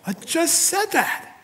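high, slow, put-out reply; mock impatience; exasperated retort. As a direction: act the exasperated asker. Petitioner retorting to Jesus.

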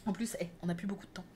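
fast whisper; quick whispered aside. Real, not acted.

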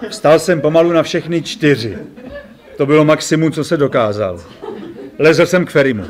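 ordinary delivery, nothing marked

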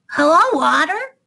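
high, loud hedgehog call; shouted greeting. Acted. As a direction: call out to a friend across the bank. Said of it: "shouted Little Hedgehog".

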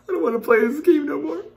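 high, whimpering complaint; scared whine. Real, not acted. Player shaken by a jump scare, whimpering. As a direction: whine it, wanting out.

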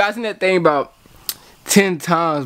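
ordinary talk

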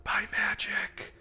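soft, theatrical whispered catchphrase; hammed-up whisper. Gamer trying out a stealth-kill catchphrase in a hammed-up whisper. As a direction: whisper it like a mock assassin.

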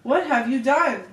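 loud alarmed yell; shocked priest yelling. A narrator's reading. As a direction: cry out in horror at the sight. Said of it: "and yelled".

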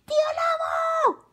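piercing, extremely high scream. A narrator's reading. Carmela losing patience at the door, piercing shout. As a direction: scream the name at full pitch. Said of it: "gritó más fuerte".

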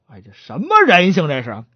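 ordinary delivery

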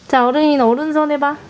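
ordinary talk

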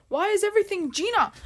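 loud, high, fast outburst; exasperated outburst. Real, not acted. Streamer fed up with hacker Gina turning up everywhere, exasperated outburst. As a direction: burst out in exasperation.

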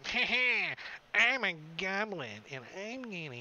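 hammed-up goblin swagger; gleeful goblin voice. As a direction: gloat like a goblin mid-attack. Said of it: "He's like".

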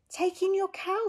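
high bright voice for the wise man; wise old sage. Acted. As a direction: give the advice cheerfully. Wise old man's advice.

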